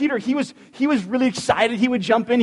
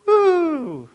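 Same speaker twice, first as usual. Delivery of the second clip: high cheering whoop; excited woo; 10 semitones higher than usual. Real, not acted. Pastor cheering the congregation with a high woo. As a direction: whoop to rev up the crowd. Cheering about Easter service.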